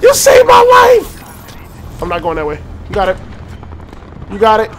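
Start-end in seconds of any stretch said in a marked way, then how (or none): 0.0-2.0 overjoyed cry. Real, not acted. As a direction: cry out like you were just saved. Teammate saves him.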